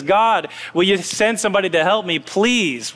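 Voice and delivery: ordinary delivery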